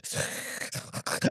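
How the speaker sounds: soft, wordless rasping dog breath; low-volume mock panting; imitated dog noise. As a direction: imitate an excited old dog huffing. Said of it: "the dog sees me and he goes".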